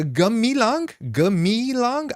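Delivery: Gamilang high and slow, long mee; big me. Shown as a demonstration. Streamer testing a name, high drawn-out Gamilang. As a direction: stretch Gamilang as guh-MEE-lang, questioning. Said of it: "that is a big me".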